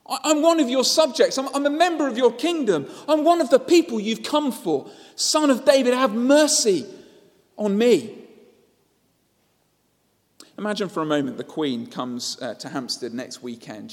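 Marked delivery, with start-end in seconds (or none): 0.0-8.2 much higher, loud pleading shout; desperate cry. A narrator's reading. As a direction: beg the king not to pass you by. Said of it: "he cries out".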